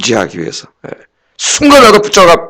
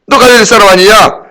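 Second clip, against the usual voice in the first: 따지는 말투; 6 semitones higher, 4 dB louder than usual. Retold or imitated. Bystanders accuse Peter.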